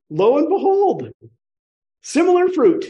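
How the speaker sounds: high, loud, sung-out reveal; mock triumphant. Teacher announces what the radio voice brings, mock triumphant. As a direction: proclaim it high and loud, drawing out the ends. Comic payoff of the radio example.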